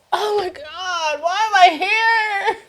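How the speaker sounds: high, slow, crying wail; mock sobbing wail. Retelling her first-day breakdown, high sobbing wail. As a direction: wail it out like a lost new kid.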